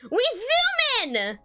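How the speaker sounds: high, drawn-out excited cry; playful stretched-out high yell; mild scream. A narrator's reading. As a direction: squeal it slowly, way up high. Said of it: "and screams".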